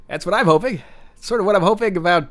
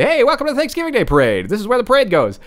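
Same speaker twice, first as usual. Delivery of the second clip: announcer voice; 6 semitones higher than usual. Retold or imitated. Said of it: "Then he would say".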